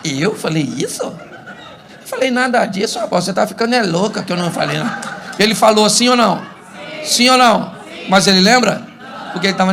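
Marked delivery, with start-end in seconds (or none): 0.0-5.2 stretched-out innocent denial; husband feigning innocence. Acted. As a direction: play the husband denying it all, incredulous. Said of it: "ele olha pra você e diz assim".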